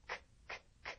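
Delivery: 크 released as a soft puff, no vowel; unvoiced k. Shown as a demonstration. Teacher isolating the last sound of walk, voiceless 크. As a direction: click out each 크 without voice. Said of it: "안 울리니까 walks".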